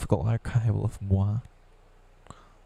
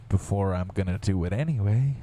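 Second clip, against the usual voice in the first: ordinary delivery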